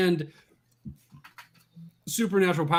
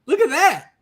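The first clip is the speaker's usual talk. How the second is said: excited; 8 semitones higher than usual. Real, not acted. Excited at seeing book.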